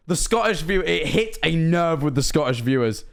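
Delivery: normal talking voice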